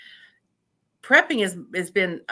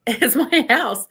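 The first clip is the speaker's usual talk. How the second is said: laughing joy; 5 semitones higher, 6 dB louder, slower than usual. Retold or imitated. Laughing reply to weeping owner.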